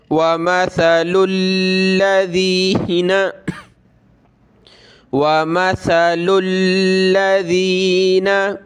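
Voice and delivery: slow, drawn-out tilawat; Quran recitation. Shown as a demonstration. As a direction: recite the verse slowly with melody. Recites the word slowly. (धीमी सुरीली तिलावत)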